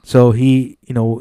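ordinary talk, nothing marked

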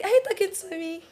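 high, startled surprise; retold flustered surprise, high-pitched; surprised. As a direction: act caught off guard, pitch jumping up. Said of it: "I was like".

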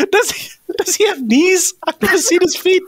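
pitched well up, almost squeaking through giggles; squeaky giggly wonder about Yoda; giggly high voice. Real, not acted. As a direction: lift into a giggly high register. Laughing bit about Yoda's feet.

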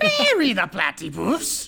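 high, drawn-out cartoon villain; hammed-up accented villain cry; Doctor Doofenshmirtz. Teased friend plays along, cartoon villain voice. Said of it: "more like Doctor Doofenshmirtz".

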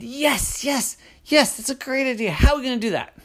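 high, excited dragon voice; eager dragon. Acted. The dragon cheers Crystal's naming idea, high and eager. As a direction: bounce with eagerness. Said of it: "the dragon said".

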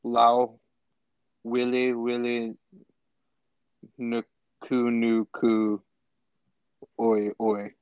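"Lao wili wili nuku nuku oi oi" in slow, separated chunks; sounding out Hawaiian. Shown as a demonstration. Friend retrying a long Hawaiian fish name, halting and careful. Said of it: "attempt to, uh, pronounce it".

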